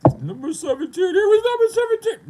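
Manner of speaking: very high, loud wail; hammed-up crybaby shriek; mock crying. Beaten player's friend crying over the ranking, mock wail. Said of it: "You have to cry about it".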